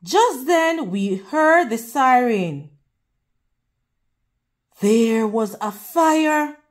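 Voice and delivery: plain reading voice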